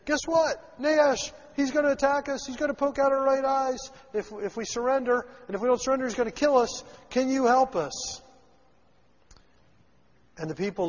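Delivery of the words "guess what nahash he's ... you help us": high, urgent messengers pleading; urgent plea. Retold or imitated. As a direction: beg for help with a rising alarm, slowing at the end. Messengers begging town for help.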